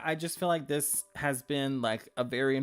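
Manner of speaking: ordinary talk, nothing marked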